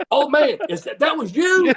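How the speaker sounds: high-pitched retold astonishment; feigned amazed recognition. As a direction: play along, acting amazed to recognize him.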